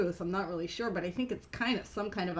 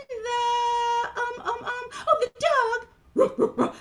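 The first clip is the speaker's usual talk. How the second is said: high, hesitant puppet voice, then triumphant; Mimi; 12 semitones higher, 6 dB louder, much slower than usual. Acted. Mimi tries to remember the animals, high puppet voice. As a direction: fumble as a tiny character, then blurt it.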